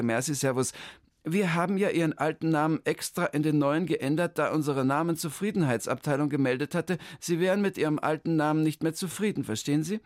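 high, chirpy clerk voice; the clerk rattles off her greeting, high; official lady. Acted. The town-hall clerk greets Hermann, high and chirpy.

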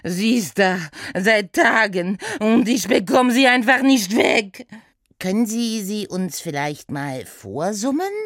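höher, lauter, mit französischem Akzent klagend; verzweifelter Franzose. Acted. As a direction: gehetzt um Hilfe flehen, französischer Akzent. Saint-Saëns voice. (verzweifelt, französischer Akzent)

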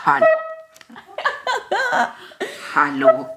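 through a tiny megaphone; toy megaphone. Real, not acted. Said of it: "sprechen Sie hinein".

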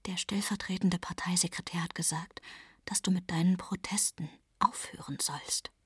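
hushed, careful whisper; whispered warning to her boyfriend; quietly. Acted. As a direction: keep it from the men at the next table. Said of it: "sage ich leise".

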